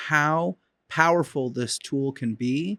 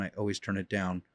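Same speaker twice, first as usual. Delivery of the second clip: "I always turn it down." dropped much softer, with "always" still stressed; quiet repeat; 7 semitones lower, 10 dB quieter than usual. Shown as a demonstration. Trainer repeating the point to owners, trailing off quietly. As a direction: let "always" fall away to a near murmur. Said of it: "I'm saying it louder".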